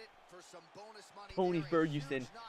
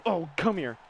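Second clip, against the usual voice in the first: ordinary talk, nothing marked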